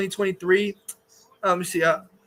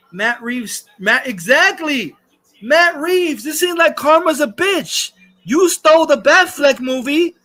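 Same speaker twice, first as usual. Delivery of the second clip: gloating read; 5 semitones higher, 4 dB louder than usual. Retold or imitated. Reading gloating chat message aloud.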